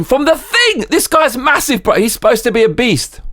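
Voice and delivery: exasperated high-pitched rant; incredulous outburst. Real, not acted. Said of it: "indignant outburst".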